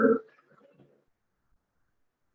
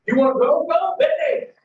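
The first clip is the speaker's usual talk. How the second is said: high-pitched shouted send-off; defiant dismissal; 10 semitones higher than usual. Acted. Man telling his new girlfriend she can go, defiant shout. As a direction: call it out high, as a dare to leave.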